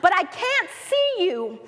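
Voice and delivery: mock panicked child calling out; child's frantic call. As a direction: call out to your dad from the burning roof. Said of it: "he yelled to his father".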